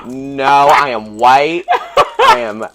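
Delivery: hammed-up sassy objection, words stretched out; mock indignant refusal. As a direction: put your foot down, playfully. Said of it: "And I was like".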